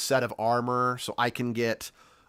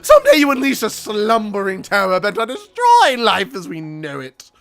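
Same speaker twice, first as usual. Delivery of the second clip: high, louder pompous scholar; posh professor voice; 9 semitones higher, 12 dB louder than usual. Acted. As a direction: bluster like a jolly old academic.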